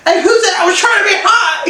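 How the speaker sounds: high, loud indignant retort with a laugh; hammed-up offended comeback, half laughing; mock indignation. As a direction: protest loudly, pitch shooting up. Acting Hardin's indignant retort.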